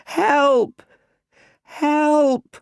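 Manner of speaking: put-on long, very high child's yell; terrified shout. A narrator's reading. Boy curled up under flying bats, shouting in terror. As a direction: yell for help in a small boy's piercing high voice. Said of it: "and shouts".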